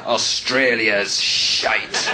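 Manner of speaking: put-on low, very slow Scottish drunk growl; drunk Scottish heckler. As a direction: slur it like a drunk Scot at the front. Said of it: "he just went".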